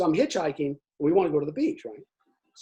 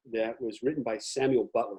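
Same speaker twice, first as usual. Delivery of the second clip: normal talking voice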